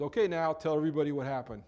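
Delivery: fast, loud, commanding call; Jesus calling her out before the crowd; loud demand. Acted. Jesus calling the woman out, loud and quick.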